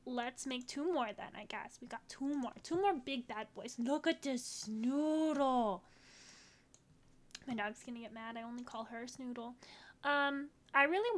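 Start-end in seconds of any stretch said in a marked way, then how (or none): 3.8-5.8 doting coo. Real, not acted. Cooing over a cute puppy.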